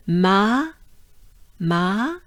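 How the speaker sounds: "Ma" held with an open French A, rising; drawn-out "ma". Shown as a demonstration. Teacher prepares to go on with name, drawn-out "ma". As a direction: stretch "ma" and lift the end. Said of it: "make it sound like this".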